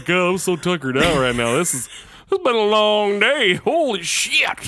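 hammed-up sleepy yawn; fake yawning. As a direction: stretch words like a tired yawn. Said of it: "you were fake yawning so much".

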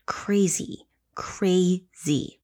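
soft unvoiced whisper of disbelief. Real, not acted. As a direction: breathe the word out, stunned. Whispered reaction to abduction.

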